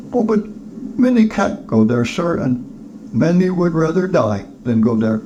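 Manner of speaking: high-pitched, halting objection; charity collector, protesting. Acted. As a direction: object pleadingly in a high character voice.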